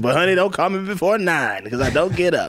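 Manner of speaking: high, sassy diva voice; diva sass. Retold or imitated. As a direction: toss it off like a diva. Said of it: "And then she goes".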